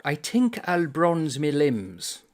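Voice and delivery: a slow, lilting read of the character's line; lightly voiced Irishman. Reading the Irish character sunning his legs, slow lilt.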